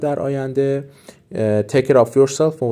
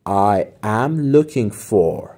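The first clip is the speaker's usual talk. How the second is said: slow model phrase; 2 semitones lower, much slower than usual. Shown as a demonstration.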